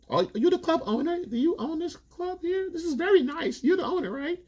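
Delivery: falsetto fake-sweet mockery; sugary high voice hiding the knife; catty club woman. Club girls taunting the older woman about her son, falsetto fake-sweet.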